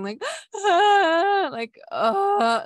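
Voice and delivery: high, loud mock wail; imitated wailing. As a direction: act out a big sobbing wail after a gasp. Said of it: "like, wailing".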